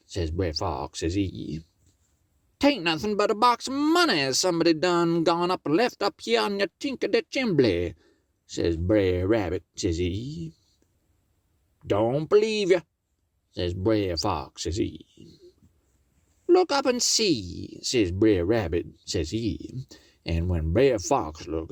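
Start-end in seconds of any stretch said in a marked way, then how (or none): 2.6-7.9 high voice, rattled off quickly; fast high rabbit fib. Acted. Brer Rabbit fibbing to Brer Fox, fast and high. 11.8-12.8 raised pitch for the fox; sweet-talking the folks as the fox; high sly fox voice. Acted. Brer Fox greeting the folks from the door, high sly voice. 16.5-17.8 pitched high and playful; high teasing rabbit voice. Acted. Brer Rabbit teasing Brer Fox from the chimney, high and playful.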